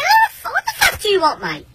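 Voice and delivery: high-pitched irritated challenge at the door; angry confrontation. Retold or imitated. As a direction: demand to know what he wants, voice jumping up. Annoyed man confronting neighbour at door.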